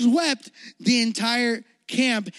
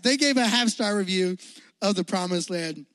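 usual speaking voice

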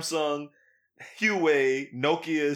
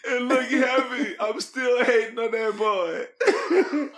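high, slow, weak from laughing; laughed-out words; 8 semitones higher, 3 dB louder, much slower than usual. Real, not acted. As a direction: squeeze it out through leftover laughter. Still recovering from laughing fit.

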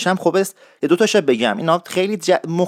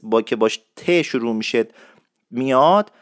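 usual speaking voice

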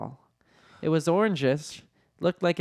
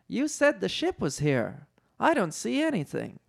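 raised pitch, doubting complaint; high character voice, questioning; Leia; 6 semitones higher than usual. A narrator's reading. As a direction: voice a puzzled general, pitched up. Leia challenges the admiral.

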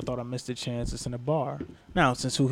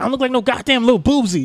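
higher, louder imitation of an offended woman; mad heckler woman; 10 semitones higher, 11 dB louder than usual. Comic imitating an offended woman heckler, mock-angry and loud. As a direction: throw her angry comeback back at him, mock-indignant. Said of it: "She got mad".

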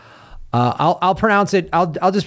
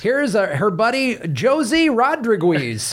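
higher, hammed-up American announcer mangling Spanish names; mock gringo pronunciation; 5 semitones higher, slower than usual. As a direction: drag out Josie Rodriguez in overdone American English. Continues the mock gringo naming.

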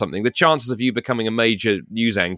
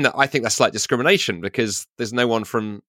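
usual speaking voice

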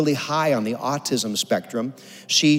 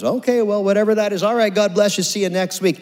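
higher-pitched brush-off sign-off; dismissive pastor; 6 semitones higher than usual. Retold or imitated. Pastor grabbing the mic to dismiss everybody, high brush-off. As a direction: wrap up the service over an awkward moment. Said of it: "grabs the mic, and goes".

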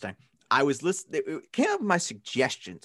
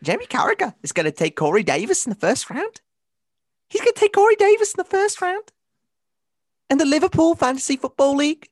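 high, loud Scouse Gerrard impression; hammed-up Liverpool accent, pitched well up and loud; 5 dB louder than usual. Mimicking Steven Gerrard's Scouse accent for a podcast co-host. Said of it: "it's a pretty good Scouse accent".

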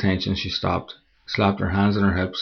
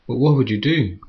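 lightly raised, playful tease; smiling, teasing; 4 semitones higher, 5 dB louder than usual. Acted. Girlfriend teasing him from the cliff edge, playful smile. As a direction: coax him with a grin. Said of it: "she smiled".

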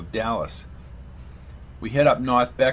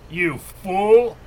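a loud, higher snarl of contempt; growled; 7 semitones higher, 6 dB louder than usual. A narrator's reading. Granby snarls at Patch holding a gun to him, angry growl. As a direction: spit it out at him, furious. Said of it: "growled Granby".

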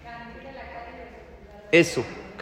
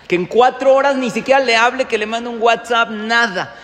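animated retelling; 6 semitones higher, 8 dB louder than usual. Retold or imitated. Father retelling wife's demand.

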